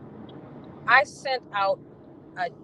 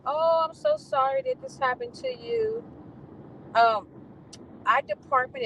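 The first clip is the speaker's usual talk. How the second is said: mock sympathy; 14 semitones higher than usual. Quoting the director's apology email.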